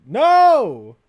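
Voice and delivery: loud, slow, shocked no; real loud dismay; shocked shout. Real, not acted. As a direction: cry out in disbelief. Checking the fake Dog Pack.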